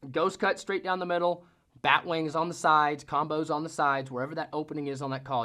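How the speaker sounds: normal talking voice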